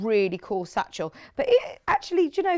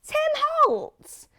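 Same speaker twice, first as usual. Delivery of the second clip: squealed far higher, louder, thrilled; excited squeal; 4 dB louder than usual. Real, not acted. Host thrilled to see the designer's fabric, squealing. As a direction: shriek the name like a fan.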